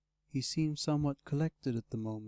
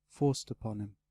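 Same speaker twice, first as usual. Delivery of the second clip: usual speaking voice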